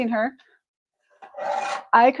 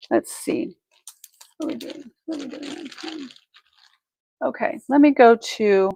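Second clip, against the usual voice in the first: ordinary talk, nothing marked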